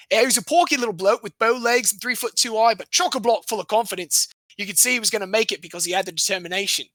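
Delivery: put-on Aussie accent, quick finish; imitated Australian youth coach. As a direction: quote him in a hammed-up Australian accent.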